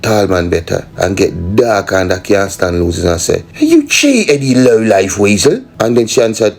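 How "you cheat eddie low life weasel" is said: high, loud furious outburst; shrill indignant yell; angry accusation. Acted. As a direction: spit it at the cheater. Sore loser insults winner.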